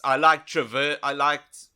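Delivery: usual speaking voice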